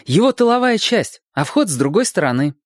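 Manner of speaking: high-pitched, eager explaining; animated boyish explanation; boy working it out. A narrator's reading. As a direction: start high as the realisation lands. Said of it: "сообразил Серёжка".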